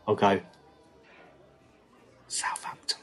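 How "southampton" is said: secretive hushed whisper. Retold or imitated. Whispering a football jibe into his mate's ear. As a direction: breathe it like a secret. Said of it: "I'll whisper in his ear".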